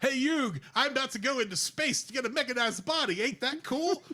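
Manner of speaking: excited anime buddy pitching a big plan; Joey impression. Joey telling his pal about going to space, cartoon dub voice. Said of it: "you said Joey before you went into wrestling. I, I, I went to Yu-Gi-Oh!".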